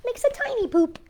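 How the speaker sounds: lively read-aloud voice for little listeners; reading to children. A narrator's reading. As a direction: read it like a bedtime picture book. Reading picture book to children.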